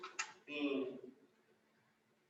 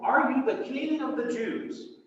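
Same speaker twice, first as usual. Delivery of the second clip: Pilate's question; 7 semitones higher, 6 dB louder, slower than usual. Acted. Pilate questioning Jesus, voiced.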